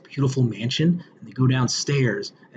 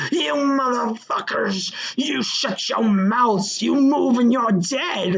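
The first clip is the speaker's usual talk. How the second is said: slow, drawn-out, higher mock threat in a put-on voice; hammed-up movie robber holding up a diner; Honey Bunny impression; 8 semitones higher, much slower than usual. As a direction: growl out a hammed-up holdup threat. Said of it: "She does the Uma Thurman".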